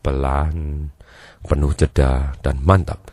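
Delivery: low, slow and deliberate; deep, steady, with long pauses; slow, paused speech. Shown as a demonstration. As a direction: drop the pitch and draw each word out. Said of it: "Kalau dianya ngomongnya lambat, pelan, penuh jeda".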